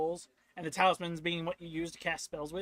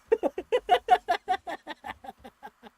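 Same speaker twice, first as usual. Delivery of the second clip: high wheezing cartoon-dog laugh; Scooby laugh; 11 semitones higher, 7 dB louder than usual. Gamer cracks up after taunting, Scooby-style laugh. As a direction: cackle like Scooby-Doo. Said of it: "sounded more like a Scooby laugh".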